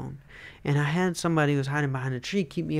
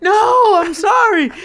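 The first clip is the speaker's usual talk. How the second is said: tearful apology; 14 dB louder, slower than usual. Retold or imitated. She cries, he apologizes.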